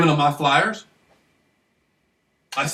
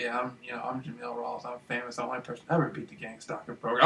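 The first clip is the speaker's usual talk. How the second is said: soft calm whisper to the woman; 5 semitones lower, 11 dB quieter than usual. Retold or imitated. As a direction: hush it, calm and close. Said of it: "I'm all whispering, all calm".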